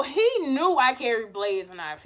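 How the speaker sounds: ordinary talk, nothing marked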